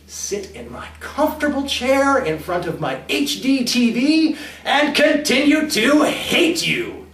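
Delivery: loud, high, mounting resentment; acted seething declaration; bitter hatred. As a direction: build to a slow, emphatic finish. Bitter alternative in a quarrel.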